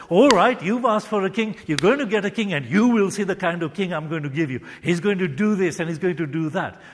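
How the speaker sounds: loud, stern, threatening father; harsh judgmental. Shown as a demonstration. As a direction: bark it out like a harsh judge. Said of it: "not spoken in a harsh, critical, judgmental way".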